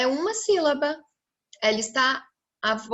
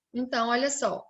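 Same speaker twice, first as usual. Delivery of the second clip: normal talking voice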